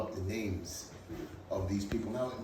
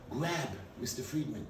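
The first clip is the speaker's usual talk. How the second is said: ordinary delivery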